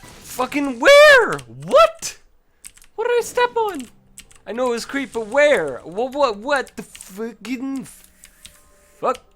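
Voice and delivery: high, loud disbelieving yell, drawn out slowly; outraged shout. Real, not acted. Gamer yelling at the trap he stepped on, high loud disbelief. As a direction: scream in real disbelief at the screen.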